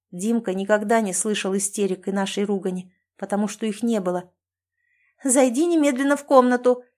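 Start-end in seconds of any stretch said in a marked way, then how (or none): 5.2-6.8 higher, louder command, НЕМЕДЛЕННО stressed; shouting at son. A narrator's reading. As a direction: order the boy away in a yell. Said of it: "кричит на него Оксана". (кричит на сына)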